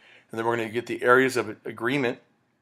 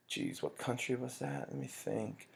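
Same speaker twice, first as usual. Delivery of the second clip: much softer, as if thinking aloud; mock pondering; 3 semitones higher, 12 dB quieter than usual. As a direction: pretend to puzzle over an obvious answer, under your breath. Feigns forgetting an obvious answer.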